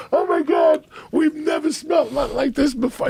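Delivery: put-on high shouted valley-girl disgust; mock cheerleader shriek. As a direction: squeal it like grossed-out cheerleaders. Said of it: "they were like".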